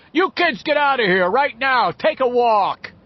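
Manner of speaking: loud, high cop's holler; shouted order. A narrator's reading. Cop at a second-floor window to kids, shouting. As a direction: yell down to chase them off. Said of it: "A cop poked his head out ... and shouted".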